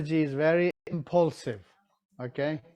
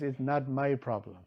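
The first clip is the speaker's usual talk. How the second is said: softer, shrugging it off; dismissive pig; 2 semitones lower, 7 dB quieter than usual. Retold or imitated. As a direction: brush it away, not my problem. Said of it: "and pig says".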